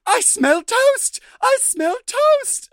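dazed patient. Retold or imitated. Retelling the heritage minute, the patient's dazed toast line. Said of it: "and she's like".

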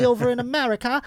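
mock Aussie presenter doing a footy rundown; mock Australian accent. Host parodying the Australian sports anchor, mock Australian accent. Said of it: "No, that's Brit-, that's English".

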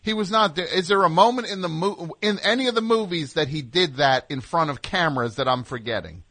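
ordinary talk

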